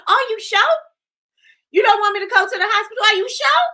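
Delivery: very high, loud caricature of the actress, "sure" as "sho"; imitating forced slang. Vlogger replays the actress's hospital line, exaggerated high slang voice. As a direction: squeal it out as a mocking impression. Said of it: "'cause she be like".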